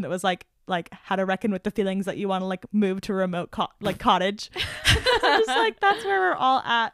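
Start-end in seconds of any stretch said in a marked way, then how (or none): 4.5-7.0 high, slowed words through laughter; really laughing while talking. Real, not acted. Host amused by remote cottage question, laughing.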